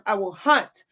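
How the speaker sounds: ordinary talk, nothing marked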